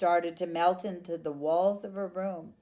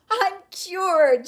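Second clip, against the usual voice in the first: loud high joyful shout; 11 semitones higher, 10 dB louder than usual. A narrator's reading. Camilla celebrates her cure, loud happy shout. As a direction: cry it out in triumph. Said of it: "she shouted".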